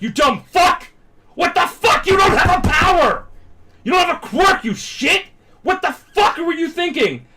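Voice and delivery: high, loud furious shouting; hammed-up angry crowd yelling; furious berating. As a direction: scream at him in acted outrage. Acts crowd berating Deku.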